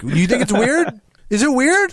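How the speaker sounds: mock incredulous. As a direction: pitch climbing up in disbelief. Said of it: "and I'm like".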